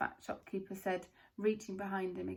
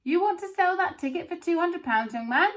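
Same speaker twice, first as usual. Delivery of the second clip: loud shout; 8 semitones higher, 9 dB louder than usual. A narrator's reading. Crowd voices shouting.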